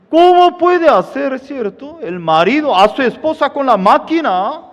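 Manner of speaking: high, loud outraged question; indignant disbelief. Real, not acted. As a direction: throw it out in disbelief. Preacher exclaims in disbelief.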